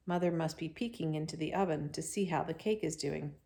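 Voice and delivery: plain reading voice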